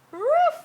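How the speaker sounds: high, loud yappy woof; playful dog bark. Pretend. Grandma barking high for the giggling baby.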